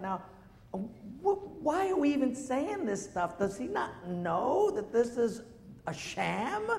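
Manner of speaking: high, indignant disbelief; incredulous. Retold or imitated. Pastor voices his shocked thought at the royal couple, high and indignant. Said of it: "And I thought".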